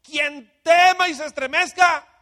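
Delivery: loud, high, slow proclamation; a leader's booming call; commanding shout. Acted. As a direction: thunder it out as Gedeón to the troops. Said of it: "Y Gedeón dijo".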